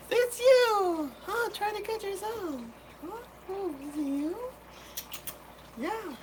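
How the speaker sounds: put-on high cooing baby talk to the fish; squeaky voice talking to a tiny fish. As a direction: coo at the little fish like a baby.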